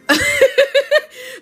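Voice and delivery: short high laugh; high burst of laughter. Real, not acted. Reader laughing at her own crying habit, high laugh. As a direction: laugh at yourself, bright and high.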